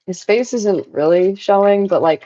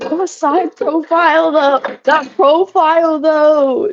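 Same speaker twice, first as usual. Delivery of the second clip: mock old lady voice; 8 semitones higher, slower than usual. Retold grandma line again.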